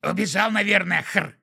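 high, loud grunting creature. A narrator's reading. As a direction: shout it like a snorting imp. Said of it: "ответил Пук".

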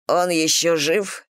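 ordinary delivery, nothing marked

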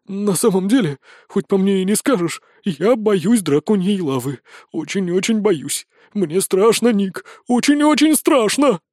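high, pleading fear rising to a cry; frightened confession. A narrator's reading. Wizard admits fear of dragon lava to friend, frightened. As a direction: confess it in mounting panic, pitch climbing at the end.

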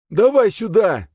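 high, loud order to hand over; forceful high yell; shouted demand. Acted. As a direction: demand it at gunpoint. Gunman demands the sword.

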